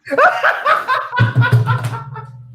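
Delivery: very high, loud peal of laughter; uproarious laugh. Real, not acted. Host cracking up at a bad country impression, laughing. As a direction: burst out laughing, high and long.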